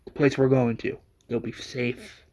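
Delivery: soft, subdued, halting confession; hushed, understated grief with long pauses; tearful apology. A narrator's reading. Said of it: "in tears".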